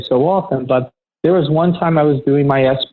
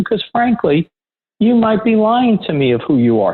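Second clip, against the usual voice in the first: higher, pointed challenge; retold defiance; 8 semitones higher than usual. To the facility manager demanding a name, defiant and higher. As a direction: push back hard at a stranger on the phone.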